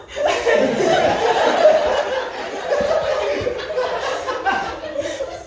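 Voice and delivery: high, loud laughing on the edge of tears; teary laugh. Real, not acted. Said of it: "Don't cry".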